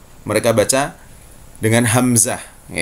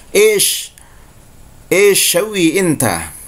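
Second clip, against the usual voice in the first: slow Saudi "ish shawi inta", a higher pitched "Ish" to open; Saudi Arabic; 4 semitones higher, slower than usual. Shown as a demonstration. Teacher showing students the Saudi way to ask. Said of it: "orang Saudi akan mengatakan seperti itu".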